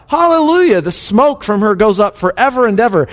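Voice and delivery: exultant reading, pitched high; crying out. A narrator's reading. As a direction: cry the praise out as scripture. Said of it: "Once more they cried out".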